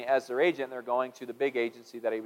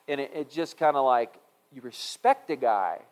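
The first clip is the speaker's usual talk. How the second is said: ordinary delivery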